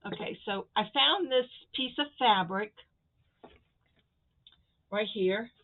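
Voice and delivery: ordinary talk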